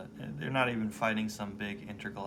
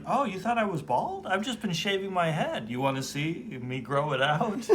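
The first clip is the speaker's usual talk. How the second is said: mock voice; 7 semitones higher than usual. Imitating Atom's bragging.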